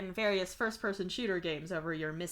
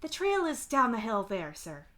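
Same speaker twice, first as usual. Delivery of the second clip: polite; 2 semitones higher than usual. A narrator's reading. Woman pointing a tourist to the trail, polite. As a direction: politely direct someone away, lightly voiced. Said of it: "the woman says politely".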